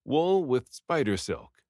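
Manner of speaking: plain reading voice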